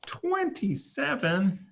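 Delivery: drawn-out, high questioning repeat; questioning disbelief. Real, not acted. Game master double-checking a rolled number, drawn-out disbelief. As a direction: stretch it slowly upward as a puzzled question.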